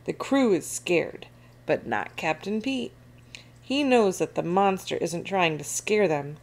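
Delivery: plain reading voice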